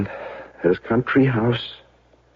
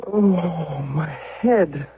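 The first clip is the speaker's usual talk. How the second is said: pained moan, pitched up; hungover groan; 6 semitones higher, much slower than usual. Acted. Hungover husband groaning to wife about his head, pained. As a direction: groan through a pounding headache.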